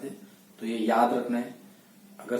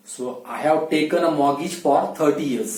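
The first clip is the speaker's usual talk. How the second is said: slow example sentence; much slower than usual. Shown as a demonstration. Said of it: "teacher models example sentence slowly".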